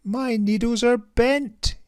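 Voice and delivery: high, loud flustered splutter; spluttering tailor. Acted. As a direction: sputter it out in dismay. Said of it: "he said with a splutter".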